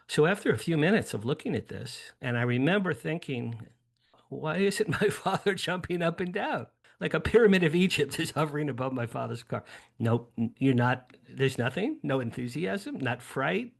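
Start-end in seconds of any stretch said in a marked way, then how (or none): 4.3-9.6 higher, incredulous inner voice, speeding up at the end; acted disbelief. Teen wondering at his father's indifference, mock incredulous. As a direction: lightly act out a baffled kid's exasperation. Teen's baffled thought, acted out.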